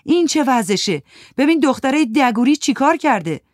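shouting café owner. Acted. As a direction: yell at the mess in the café. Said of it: "داد زد". (فریاد خشمگین صاحب کافه با صدای بلندتر)

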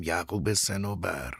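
soft, tender address; hushed, fading on the last word; gently quiet. A narrator's reading. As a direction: speak softly to an old acquaintance. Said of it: "به آرامی کنارش رفتم و گفتم".

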